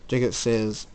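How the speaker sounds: ordinary delivery, nothing marked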